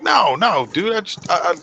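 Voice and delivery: high, insistent mock protest; imitating eager Japanese guy. Japanese guy insisting to Red he's serious, high pleading voice. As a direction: play the curious guy begging to be believed. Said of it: "And they're like".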